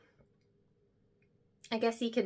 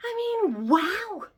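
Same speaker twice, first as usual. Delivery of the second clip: much higher, giddy wow; starstruck; 12 semitones higher, 4 dB louder, slower than usual. Acted. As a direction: gush with disbelief. Starstruck reaction to the name.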